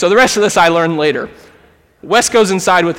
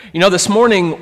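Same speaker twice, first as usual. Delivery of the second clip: ordinary talk, nothing marked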